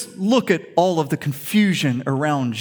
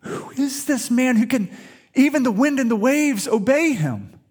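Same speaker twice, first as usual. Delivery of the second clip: amazed disciples; 7 semitones higher than usual. Retold or imitated. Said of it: "are like".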